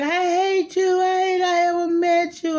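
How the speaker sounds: high, loud mock cry of hate; mock teen outburst. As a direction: imitate a furious teenager, pitched high. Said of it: "she was like".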